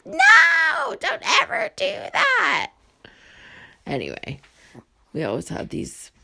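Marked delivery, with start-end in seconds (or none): 0.1-2.7 loud, high, crying-out no; panicked shout. Retold or imitated. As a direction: shout it in a panic, much higher. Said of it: "I was like".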